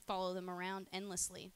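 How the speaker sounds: ordinary talk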